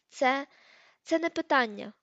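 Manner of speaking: уривчасто, голосно, з паузою після першого слова; затнися, втрачаючи самовладання; затинаючись. A narrator's reading. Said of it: "почала вона затинатись". (збите, схвильоване затинання)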